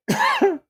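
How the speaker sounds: a sharp, high, loud laugh bursting out. Real, not acted. Host laughs at a silk-screened knife, loud and high.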